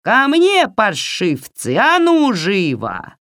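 shrieky, drawn-out witch command; shouted summons. A narrator's reading. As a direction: holler for the minions like a witch. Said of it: "Яга крикнула".

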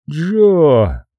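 ordinary delivery, nothing marked

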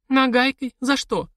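high, alarmed double question; startled widow. Acted. Widow startled by whip warning, high alarmed voice. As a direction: recoil at the word. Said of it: "shocked at whip threat".

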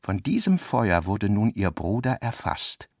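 ordinary talk

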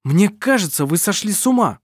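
loud, high indignant outburst; shouting. Acted. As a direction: cry it out in disbelief. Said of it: "вскричал Адриан".

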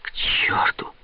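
whispered curse to himself; muttering to himself. A narrator's reading. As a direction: whisper it bitterly under your breath. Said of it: "говорил он сам себе". (шёпотом, сам себе)

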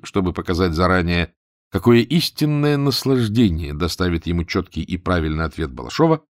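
usual speaking voice

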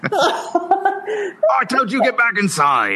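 slow, high mock-stern father; scolding father. Slaver father orders his daughter back inside. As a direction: drag out a hammed-up scolding.

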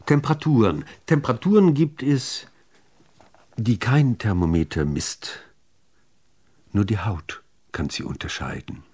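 usual speaking voice

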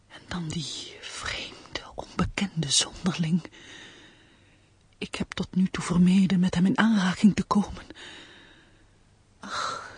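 intense stage whisper, mostly voiceless; anxious soliloquy. Acted. Woman finally alone, fearing the stranger at supper, anxious whisper. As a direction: breathe out the secret dread, with long pauses between thoughts. Alone, confesses secret dread.

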